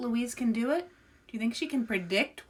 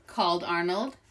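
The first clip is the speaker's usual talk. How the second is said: usual speaking voice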